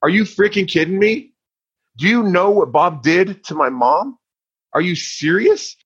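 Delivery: put-on a teen's stunned, indignant inner outburst; incredulous outrage. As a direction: voice shoots way up in disbelief. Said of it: "but I was thinking".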